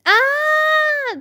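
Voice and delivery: high, loud sustained scream; long screech. A narrator's reading. Two girls screaming to scare the babysitter, long screech. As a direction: screech "ah" at full pitch and hold it. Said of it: "they screeched".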